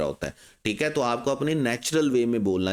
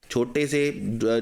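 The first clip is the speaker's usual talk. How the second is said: usual speaking voice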